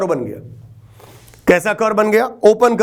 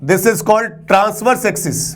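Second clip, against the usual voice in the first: slow dictation; much slower than usual. Shown as a demonstration. Teacher dictates key term.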